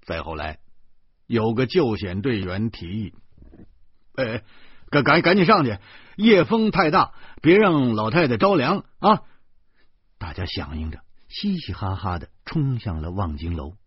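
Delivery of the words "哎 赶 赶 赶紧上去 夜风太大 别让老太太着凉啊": loud, high shout over the wind; hurrying a crowd along; 救援队员大声催促; urgent call. Acted. Rescuer urges everyone upstairs.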